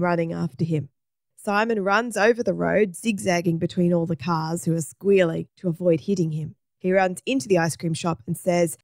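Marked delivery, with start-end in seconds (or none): none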